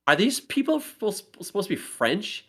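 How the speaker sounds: half-hammed incredulous question; retold disbelief. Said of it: "I'm like".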